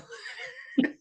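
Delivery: very high breathy laugh; an embarrassed giggle, high up. Real, not acted. Laughs at feeling weird.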